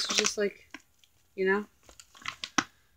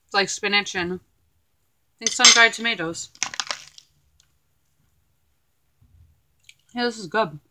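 talking while chewing; muffled chewy speech; mouth full; 3 semitones higher than usual. Real, not acted. Seen 1: Fork bite at -1; lips work through words; bites chicken again at 4.6.

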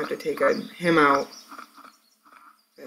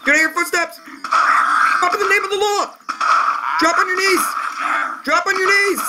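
high shouted police orders; cop yelling commands. Pretend.

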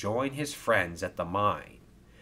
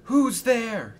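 high, startled, scared question; frightened gasp; 14 semitones higher, 5 dB louder than usual. A narrator's reading. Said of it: "gasped Oliver".

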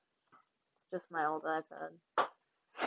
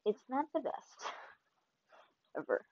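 mock gloom; 6 semitones higher than usual. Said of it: "I'm just kidding".

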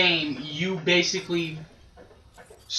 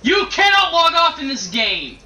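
loud and high, fed up; exasperated shout; 6 semitones higher, 5 dB louder than usual. Real, not acted. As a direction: insist loudly that quitting is impossible. Gamer defending staying in match.

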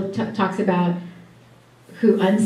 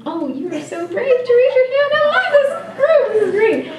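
delighted high praise. Real, not acted. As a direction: squeal the praise, high and laughing. Praising the brave hand-raisers.

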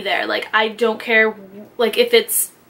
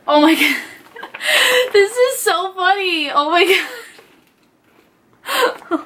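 laughing through it, pitch jumping higher; real laughter breaking the words; 7 semitones higher than usual. Real, not acted. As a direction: giggle out the words, cracking up. Laughing at a funny card.

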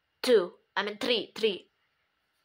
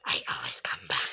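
a whispered villain taunt; spooky whisper; 8 dB quieter, faster than usual. Pretend. Kid voicing a game villain in a spooky whisper. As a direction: breathe it like a lurking ghost.

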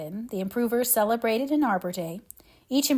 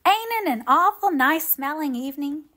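delighted; 6 semitones higher, 3 dB louder than usual. A narrator's reading. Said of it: "sniffing delightedly".

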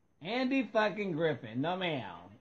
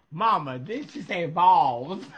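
mock kid asking mama; 8 dB louder than usual. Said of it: "I would have repeated all that".

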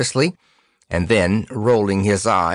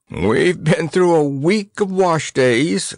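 high, slow groan; groaning complaint; 8 semitones higher, slower than usual. A narrator's reading. Percy rueful after a hard journey, groaning. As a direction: groan it out wearily, stretching the words. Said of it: "groaned Percy Vere".

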